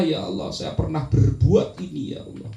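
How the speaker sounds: a hushed repentant dhikr; remorseful prayer. Shown as a demonstration. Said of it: "Ingat dosa-dosa kita".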